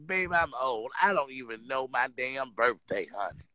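put-on fading old grandma who forgot her birthday; very old faint voice. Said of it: "I'm acting like I'm the goddamn grandmammy".

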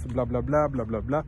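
ordinary talk, nothing marked

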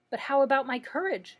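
understated anxious plea; anxious asking. A narrator's reading. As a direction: press the question with a touch of worry. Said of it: "asked the Lion anxiously".

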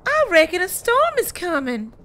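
ordinary talk, nothing marked

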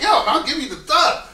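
playing a thug for a nervous director. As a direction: announce the thug persona. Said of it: "I was like".

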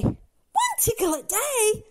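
high, slow, incredulous question; dismayed disbelief. Acted. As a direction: echo the rule in dismay. Dismayed echo of the rule.

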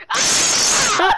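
high, loud scream with laughter; loud yell. Acted. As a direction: scream in a play fight. Play fight in the game.

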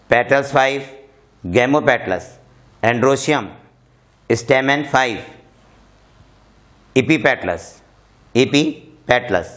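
term by term with long pauses; slow reading. Shown as a demonstration. Teacher reads floral formula for students to follow, slow reading. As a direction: read slowly, leaving space after each term. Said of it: "मैं slow पढ़ता हूँ".

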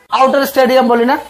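ordinary talk

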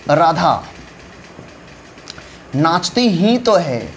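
नाचती ही तो है drawn out slowly with weight; stress on नाचती. Shown as a demonstration. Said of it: "कौन शब्द ... स्ट्रेस डाला जा रहा है". (नाचती पर ज़ोर देकर धीरे)

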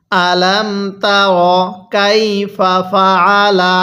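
slow, drawn-out "আলাম তার কাইফা ফাআলা"; model recitation. Shown as a demonstration. Teacher shows how to read, slow "আলাম তার কাইফা ফাআলা". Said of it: "আরেকবার আমার সাথে পড়ুন".

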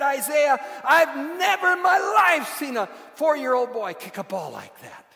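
very high, full-voiced hollering praise; shouted amazement. Preacher acting out his praise of a four-year-old, shouting. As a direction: holler it in wild admiration.

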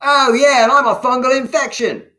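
loud, high, quick heckle; mocking. A narrator's reading. A soldier at the back doubts Pox, mocking. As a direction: jeer it fast and high from the crowd. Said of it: "mocked a voice at the back".

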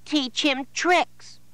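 put-on high child voice.

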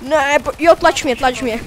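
usual speaking voice

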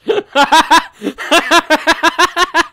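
loud high burst of laughter; big high laugh. Real, not acted. Laughing hard at the misread title, loud and high. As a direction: crack up loudly.

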